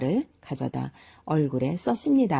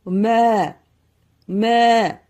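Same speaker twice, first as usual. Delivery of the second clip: higher, louder mooing; the cow-man mooing back at the old man; 5 semitones higher, 6 dB louder, slower than usual. A narrator's reading. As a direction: moo up high and loud. Cow moos in reply.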